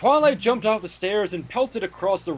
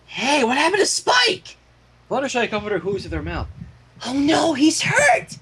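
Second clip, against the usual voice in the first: alarmed ponies; 7 semitones higher than usual. A narrator's reading. Characters alarmed for Spike.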